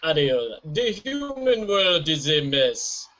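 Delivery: Caribbean-accented cartoon crab; Sebastian. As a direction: do a hammy Caribbean crab impression. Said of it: "Sebastian from The Little Mermaid".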